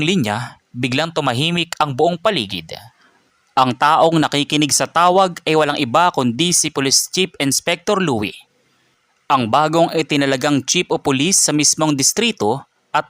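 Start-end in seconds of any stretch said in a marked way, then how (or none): none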